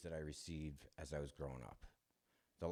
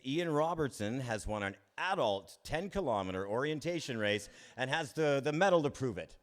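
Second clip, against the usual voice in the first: loud, high school-intercom announcer voice; PA announcement; 8 semitones higher, 11 dB louder than usual. Retold or imitated. Principal announcing over the school PA, loud announcer voice. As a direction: boom it out like a principal on the PA. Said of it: "over the P A system, it says".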